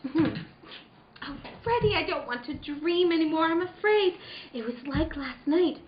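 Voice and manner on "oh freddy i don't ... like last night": quiet fear. Acted. Frightened wife confides quietly.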